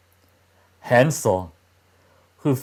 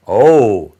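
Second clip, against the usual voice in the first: loud, bursting Oh; a boy's loud cry of joy at the candy house; excited exclamation; 9 dB louder than usual. A narrator's reading. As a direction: blurt it out in delight. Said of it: "exclaimed Hansel".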